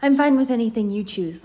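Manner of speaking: mocking people who won't choose; mock people-pleaser. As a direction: parody an annoyingly agreeable friend. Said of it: "when people are like".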